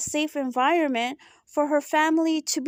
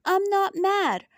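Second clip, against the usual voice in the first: higher, drawn-out put-on denial; mock false reassurance; 5 semitones higher than usual. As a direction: imitate someone lying that they aren't mad. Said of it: "you're lying in her face".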